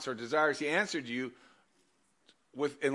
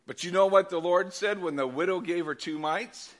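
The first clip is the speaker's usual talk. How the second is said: ordinary delivery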